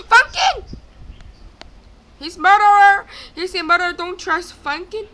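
high, loud cry of warning; alarmed shouting. Real, not acted. Player warns of a murderer, alarmed shouting. Spots the killer in game.

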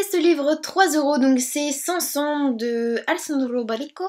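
a clumsy attempt at an Italian accent on the name. Shown as a demonstration. Booktuber showing her new book, tries an Italian accent. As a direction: roll Alessandro Baricco the Italian way, not quite there. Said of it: "Mon accent italien est à revoir".

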